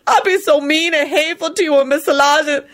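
high, tearful self-reproach; blubbering. A narrator's reading. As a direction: confess it through sobs. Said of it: "she blubbered".

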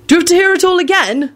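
high-pitched protest; called out to the whole room; loud announcement. A narrator's reading. George to the meeting about old notes, loud. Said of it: "George announced loudly".

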